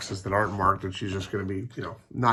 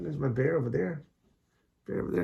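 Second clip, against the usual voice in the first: pet talk; 7 semitones higher than usual. Real, not acted. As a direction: coo at the puppies in a high voice. Cooing to the dog.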